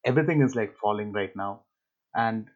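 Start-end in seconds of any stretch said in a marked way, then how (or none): 2.1-2.5 losing the thought, tapering; trailing off. Real, not acted. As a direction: drift off mid sentence. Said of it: "I lost my train of thought".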